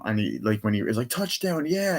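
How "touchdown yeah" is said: high-pitched pretend celebration; retold cheer, voice lifted; mock cheer. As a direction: imitate a gleeful cheer, pitched up. Acting out Sonic celebrating alone.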